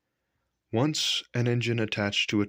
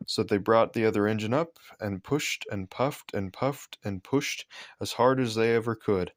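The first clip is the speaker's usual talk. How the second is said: usual speaking voice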